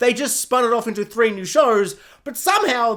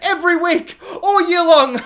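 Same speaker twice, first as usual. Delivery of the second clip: high, loud hype breaking into laughter; over-excited TV executive pitch; mock hype; 9 semitones higher, 7 dB louder than usual. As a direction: get carried away selling it. Mock excited executives hyping week.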